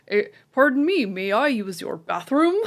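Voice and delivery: loud, exaggerated American accent asking politely; American tourist accent. American tourist in Edinburgh pub asks for bathroom, loud put-on American accent. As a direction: ham up a brash American tourist voice. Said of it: "Fucking accent".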